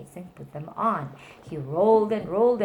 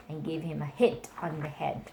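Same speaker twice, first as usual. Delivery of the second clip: ordinary delivery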